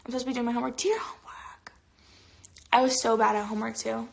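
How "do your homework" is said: whispering. Real, not acted. Playful scolding of a viewer.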